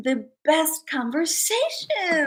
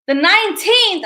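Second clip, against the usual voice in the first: excited exclaim; 6 semitones higher, 8 dB louder than usual. Real, not acted. Exclaiming the birthday date.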